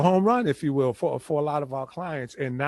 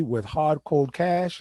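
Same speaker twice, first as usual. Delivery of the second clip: ordinary delivery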